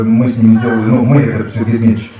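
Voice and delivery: ordinary talk, nothing marked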